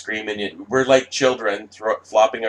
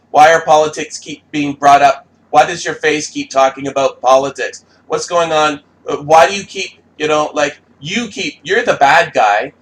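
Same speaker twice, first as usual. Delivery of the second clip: higher and louder, whiny mock repetition; mocking nagging questions; 6 semitones higher, 7 dB louder than usual. Mimicking critics badgering Gary, loud and whiny. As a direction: nag like an annoying critic.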